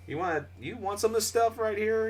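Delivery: high friendly coworker voice; retelling coworker's message offering old games; imitating coworker. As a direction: offer the stuff brightly. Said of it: "she sends me a message".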